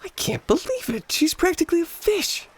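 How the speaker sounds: breathless hushed disbelief, pitched high; awed whisper. Acted. Ray, watching her dive, whispering in awe. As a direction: gasp it out in amazement.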